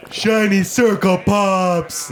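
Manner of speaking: a simpleton delighted by bubbles; dim-witted Small Fry. Acted. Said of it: "And Small Fry goes".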